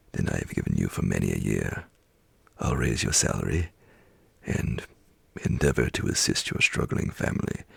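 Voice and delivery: lightly voiced reformed old man; jovial old Scrooge. A narrator's reading. Scrooge's generous speech.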